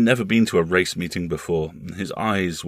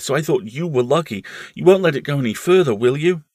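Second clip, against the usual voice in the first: put-on high, insistent child voice, a little louder; earnest small boy; 8 semitones higher, 5 dB louder than usual. Boy swearing his uncle to secrecy, high and earnest. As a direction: pour it out like a pleading boy.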